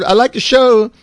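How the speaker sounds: lady fan's voice. Retold or imitated. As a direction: play the friendly lady fan. Quoting lady passing by.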